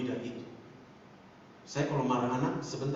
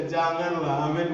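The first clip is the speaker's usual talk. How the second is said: usual speaking voice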